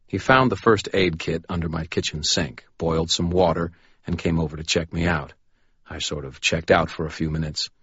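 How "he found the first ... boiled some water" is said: plain reading voice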